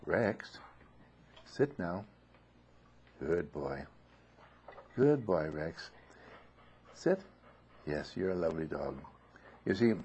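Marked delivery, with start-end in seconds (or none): 0.1-2.1 softer, gentle order to the dog; gentle command. Retold or imitated. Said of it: "when I gently say". 3.2-9.0 softer, tender praise for the dog; gentle praise. Retold or imitated. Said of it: "the gentle, kind words".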